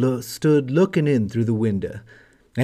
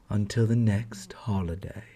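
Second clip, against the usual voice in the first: whispered ending; 5 semitones lower, 7 dB quieter than usual. A narrator's reading. Story ending.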